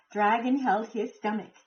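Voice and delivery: plain reading voice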